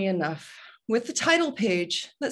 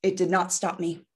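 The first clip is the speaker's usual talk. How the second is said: ordinary talk, nothing marked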